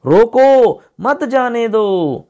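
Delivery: रोको pitched high, then long drawn मत जाने दो; pause after रोको. Shown as a demonstration. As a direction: stop after रोको, stretch the rest slowly. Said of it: "पहला वाला जो वाक्य है उसमें".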